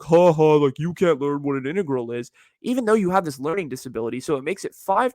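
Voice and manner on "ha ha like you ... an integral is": lightly jeering classmate imitation; mocking. Classmates making fun of someone in calculus, mocking. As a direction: taunt them with a mock laugh. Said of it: "just making fun of you".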